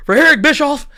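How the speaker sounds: high, loud mock sign-off; outraged commentator. Imitating the announce team signing off after the betrayal, mock outrage. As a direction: punch the name like an indignant announcer.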